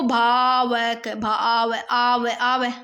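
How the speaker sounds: "भावक" slow, long aav in the middle; sounded out. Shown as a demonstration. Teacher pointing out aav, "भावक" sounded out slowly. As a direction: draw out "भावक", bhaa-vak. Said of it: "आ रहा है ना आव की आवाज".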